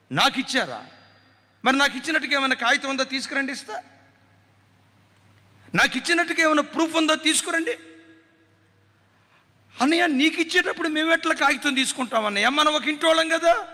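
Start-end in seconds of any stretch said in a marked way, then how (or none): 1.6-7.7 fast, dismissive, scornful brother; mock sneering brother. Cheating brother sneers at sisters. 9.8-13.7 lightly voiced protesting sister.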